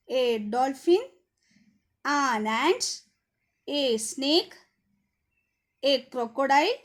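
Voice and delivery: teacher dictating a list of answers to the class; dictation read-out. Shown as a demonstration. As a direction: read each answer out for pupils to copy down. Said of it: "One more time I will say the answer".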